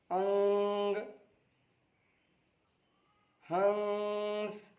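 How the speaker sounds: each word with its nasal hum held for a second, slowly; teacher stretches the nasal in अंग and हंस for pupils; nasal held demo. Shown as a demonstration. Teacher shows pupils the anusvar, nasal sound held long. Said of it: "नाक में आवाज़ ले जाकर एक second तक ठहरना है".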